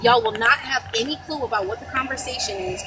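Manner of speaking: ordinary talk, nothing marked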